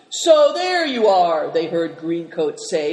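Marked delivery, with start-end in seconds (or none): 0.1-1.5 loud, drawn-out gruff greeting, pitched up; loud, slow, high-pitched gruff hail. A narrator's reading. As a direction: greet him gruffly and informally. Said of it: "His voice was gruff as usual".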